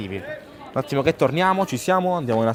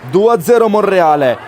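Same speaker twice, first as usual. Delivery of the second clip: excited; 5 semitones higher, 7 dB louder than usual. Real, not acted. Recap of the score.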